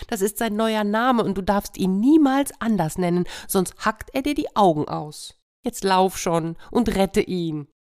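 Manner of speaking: high pleading hen voice; hen urging. Acted. Hen urging the cat to hurry, high pleading.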